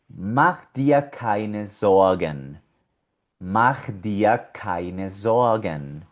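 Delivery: Mach dir keine Sorgen said slowly; slow German phrase. Shown as a demonstration. Teacher modeling the German phrase slowly. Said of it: "Vamos a leerla completa".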